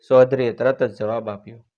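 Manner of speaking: plain reading voice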